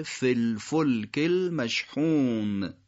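فی الفلک المشحون slowly joined, with a short "fil"; three words linked. Shown as a demonstration. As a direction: link فی الفلک المشحون syllable by syllable, drawn out. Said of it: "حرکت کشیدهٔ فی تبدیل به حرکت کوتاه". (فی الفلک المشحون شمرده و متصل، با «فِ» کوتاه)

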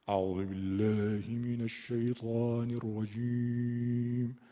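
very slow chant with lips rounded toward damma; lip-rounded recitation. Shown as a demonstration. Teacher demonstrating the rounded Egyptian vowel colour. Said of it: "انگار که یه لبشون رو به شکل ضمه".

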